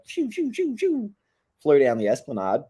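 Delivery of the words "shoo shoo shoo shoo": high whooshing shoo sounds; mock zooming noise. Cops speeding down the esplanade, high whooshing noises. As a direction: voice the cars tearing off with high shoos. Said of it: "was like".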